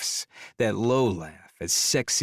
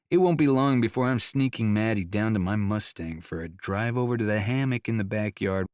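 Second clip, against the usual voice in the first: ordinary delivery, nothing marked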